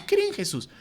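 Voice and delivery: ridiculing sign-holding evangelism with a squeaky voice; silly high mock. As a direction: pitch "Cree en Jesús" up into a goofy falsetto. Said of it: "Pongan así".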